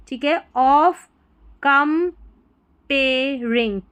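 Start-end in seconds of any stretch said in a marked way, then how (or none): 1.6-3.8 "comparing" very slowly, split com-pay-ring with long gaps; syllable by syllable. Shown as a demonstration. Teacher sounding out for learners, "comparing" in very slow syllables. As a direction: break "comparing" into drawn-out separate syllables.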